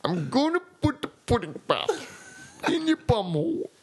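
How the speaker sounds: high, hammy Cosby voice, laughing; a terrible Cosby impression; Bill Cosby impression. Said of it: "the worst Bill Cosby impressions".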